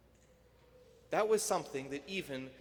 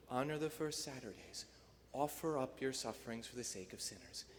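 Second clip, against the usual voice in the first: tender motherly plea; 4 semitones lower, 6 dB quieter than usual. Retold or imitated. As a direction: plead gently like a loving mother. Mary's plea, soft.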